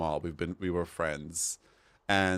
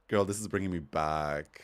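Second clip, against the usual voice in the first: ordinary delivery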